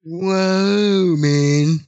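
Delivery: high and giggly; stoned, losing the thread; stoner. Real, not acted. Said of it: "I'm high as shit right now".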